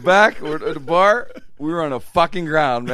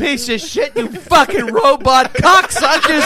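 high, loud, stretched-out cursing; hammed-up furious heckle-back; mock breakdown; 11 semitones higher, 8 dB louder, slower than usual. Comic raging at electronics guys in the crowd, enraged.

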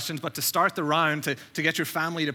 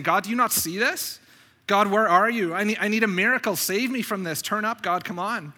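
raised, urgent pleading; crying out to God; 5 semitones higher than usual. Retold or imitated. Prophet begging God for a miracle, crying out. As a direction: beg insistently for rescue. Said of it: "Habakkuk's crying out to God".

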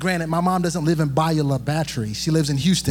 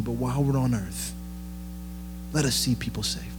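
prayer; 4 semitones lower than usual. Acted. Pastor leads prayer.

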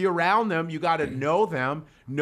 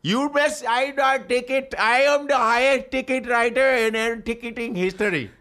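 put-on proud proclamation in a pitched-up Indian accent; 7 semitones higher, 4 dB louder than usual. Ticket writer bragging of his record, Indian accent. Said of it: "the guy that writes tickets is an Indian".

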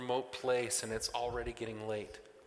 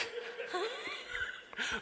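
burst of laughter; 5 dB quieter than usual. Real, not acted. Laughing at student's answer.

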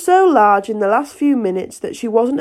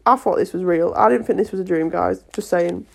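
ordinary delivery

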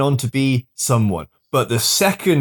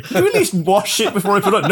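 laughing wrestler plea; 7 semitones higher than usual. Pretend.

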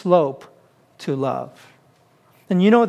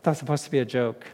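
laughing; 3 semitones lower, 13 dB quieter, much faster than usual. Real, not acted. Laughs at own joke.

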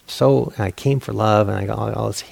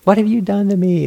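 higher and louder, hammed-up accusation; mock outraged cry; 11 semitones higher, 6 dB louder than usual. Imagined student shouting at the teachers, mock outrage. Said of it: "And like".

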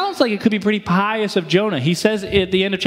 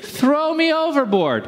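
mock Jonah plea; 6 semitones higher than usual. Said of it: "what does he suggest".

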